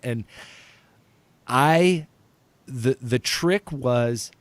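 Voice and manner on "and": ordinary delivery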